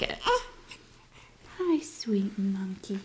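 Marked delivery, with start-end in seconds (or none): 1.6-3.0 slow tender whisper; barely voiced, hushed and loving. Real, not acted. Mom whispers a greeting to her baby daughter.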